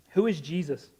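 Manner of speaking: normal talking voice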